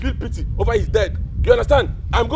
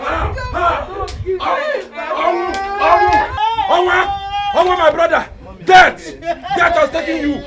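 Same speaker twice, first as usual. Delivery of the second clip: loud, high mourning cries; grief-stricken wailing; 5 semitones higher, 3 dB louder, slower than usual. Acted. Mourner begs death to take them after brother Onwu, wailing. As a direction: wail and call out to the dead.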